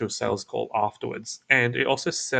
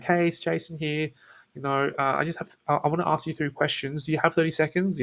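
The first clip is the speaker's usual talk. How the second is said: higher, eager launch; too salesy; 5 semitones higher than usual. Shown as a demonstration. Bad example to the lead, too salesy. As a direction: jump in keen to sell. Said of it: "that just sounds too salesy".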